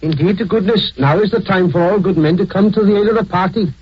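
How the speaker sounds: lilting Welsh coal miner accent; a Welsh miner saying it in his own accent. Shown as a demonstration. Demonstrating the Welsh miner accent for Americans. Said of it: "the Welshman who sounds like this".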